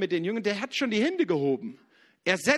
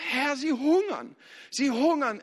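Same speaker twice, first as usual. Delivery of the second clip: higher, slow plea; pleading to the Lord; 6 semitones higher than usual. Retold or imitated. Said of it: "gehst und sagst".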